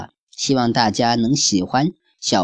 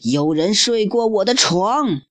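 higher, hammed-up bear roar; roaring papa bear; 9 semitones higher than usual. Papa bear finding his bed used, hammed-up roar. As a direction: bellow it like a cartoon bear. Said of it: "熊爸爸咆哮道".

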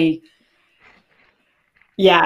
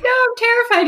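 squealed terrified protest. Retold or imitated. Retold fear of speaking.

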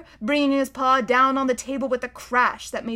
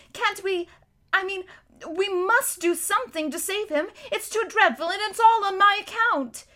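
put-on high, urgent pleading child; a little girl begging to help; 10 semitones higher, 3 dB louder than usual. Lucy pleads with Mister Beaver to save Tumnus, high and urgent.

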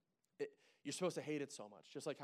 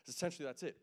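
ordinary delivery

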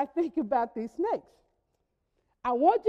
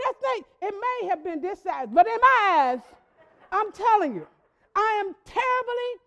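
normal talking voice

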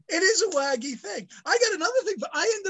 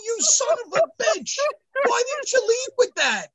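much higher, furious mock tirade; retold angry outburst; 9 semitones higher than usual. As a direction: rant at the lab like you're fed up. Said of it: "I go".

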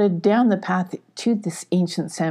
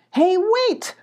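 high-pitched call to someone ahead; high urgent hail; 12 semitones higher than usual. Acted. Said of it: "called Radar".

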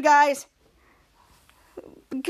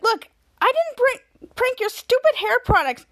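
indignant protest; 8 semitones higher, 3 dB louder than usual. Retold or imitated. Mika defending herself, retold quarrel.

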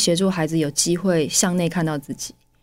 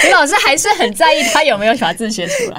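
high and louder, laughing while talking; laughing remark; 9 semitones higher, 6 dB louder than usual. Real, not acted. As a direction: tease through laughter. Listener amused by the story. (边笑边说)

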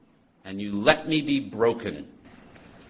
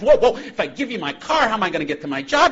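put-on flustered drunk; 11 semitones higher, 6 dB louder than usual. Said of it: "He says".

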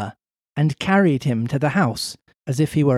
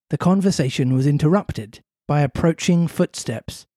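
ordinary talk, nothing marked

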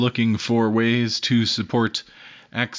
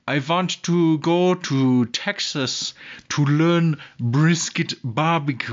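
slow, hammed-up German accent imitation; bad German accent; 2 semitones higher, slower than usual. German butcher asking about Texas brisket, slow mock German accent. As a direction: drag the words out in a clumsy put-on German accent. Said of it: "That's probably a horrible German accent".

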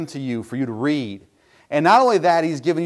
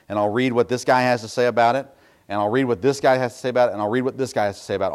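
speeding up; 2 semitones lower, faster than usual. Shown as a demonstration. Listing three commentaries, speeding up.

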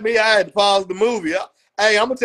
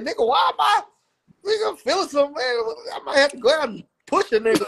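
mock self quote; 5 semitones higher than usual. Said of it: "I was like".

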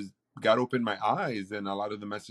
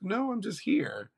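much higher, slow, innocent denial; mock innocent; 11 semitones higher, slower than usual. Son in the hospital denying he's up to something, mock innocent. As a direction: act like nothing is up. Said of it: "I'm like".

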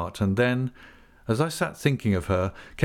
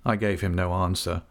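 usual speaking voice

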